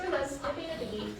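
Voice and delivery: usual speaking voice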